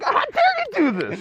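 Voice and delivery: high, laughing mock outrage. Retelling his friend's reaction, high laughing mock outrage. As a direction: squeak it out through a laugh. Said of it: "He's like".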